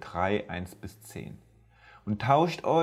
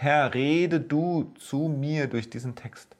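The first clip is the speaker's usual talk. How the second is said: slow, higher prayerful plea; 5 semitones higher than usual. Shown as a demonstration. Preacher voicing his prayer to God, slow and higher. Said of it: "als Gebet formuliert".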